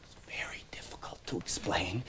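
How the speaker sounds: hushed aside; confiding under the breath; whisper. Real, not acted. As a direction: drop to a whisper.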